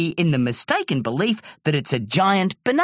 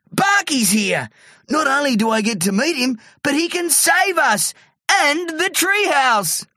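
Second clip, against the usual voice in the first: high, elated character voice; high-pitched jubilant exclaiming; excited; 11 semitones higher than usual. A narrator's reading. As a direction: build to a high, triumphant finish. Terry thrilled Barky has come.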